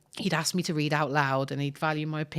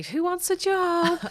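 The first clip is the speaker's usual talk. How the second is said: high, slow, theatrical teacher voice; mock teacher asking the class again; dramatic teacher; 12 semitones higher, 3 dB louder, slower than usual. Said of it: "the next day".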